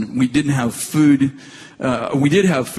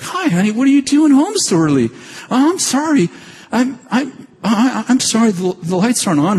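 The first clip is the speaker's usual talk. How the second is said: high, bright apologetic wife; wife caught out, high and apologetic; wife's surprised voice; 9 semitones higher than usual. Retold or imitated. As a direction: voice the flustered wife, much higher. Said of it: "She's like".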